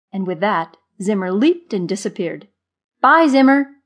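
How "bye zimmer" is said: high, loud, bright farewell; cheerful call. A narrator's reading. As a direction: call goodbye merrily after a leaving friend. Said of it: "Marva called cheerfully".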